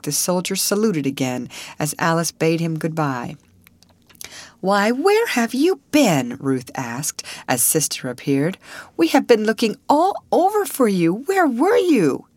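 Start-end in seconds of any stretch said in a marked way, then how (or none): 4.6-6.4 put-on high, drawn-out girlish demand; exclaiming question. A narrator's reading. Sister to Alice on her reappearing, exclaiming. 9.0-12.3 high, louder, stretched-out complaint; agitated questioning. A narrator's reading. Sister to Alice after searching everywhere, agitated.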